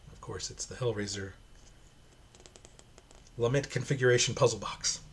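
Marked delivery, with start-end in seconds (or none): none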